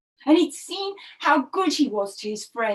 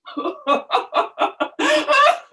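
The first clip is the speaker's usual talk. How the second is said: mocking high laugh; 10 semitones higher than usual. Proud birds laughing at the burnt parrot, high jeering laugh. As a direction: laugh down at him like a snob bird.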